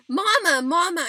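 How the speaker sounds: high, very loud holler for Mama; excited hollering. A narrator's reading. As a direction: holler urgently across a distance. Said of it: "started hollering for Mama".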